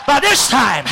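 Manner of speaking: ordinary talk, nothing marked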